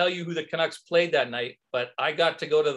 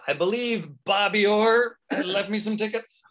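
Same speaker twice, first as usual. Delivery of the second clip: slow, higher, acting out the ticket request; savoured re-enactment; 5 semitones higher, 3 dB louder than usual. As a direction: draw out the famous name, relishing it. Re-enacts asking at will call.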